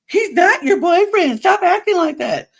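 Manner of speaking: hammed-up indignant yelling; mocking shout. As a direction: throw her words back at her, mimicking the yell. Mocks Leah's shouted double standard.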